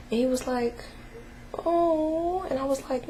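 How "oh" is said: put-on much higher, small boy's disappointed oh; son's little oh. Son's reaction, voiced by mother.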